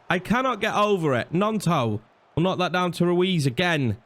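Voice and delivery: ordinary talk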